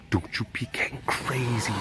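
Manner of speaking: soft whisper to the cat; whispered warning to a jumpy cat. Shown as a demonstration. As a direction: hush it, scolding gently under the breath. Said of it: "repeated plainly".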